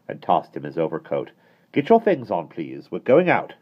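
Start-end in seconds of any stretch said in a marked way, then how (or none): 1.7-3.5 brisk nanny. A narrator's reading. Mary Poppins orders.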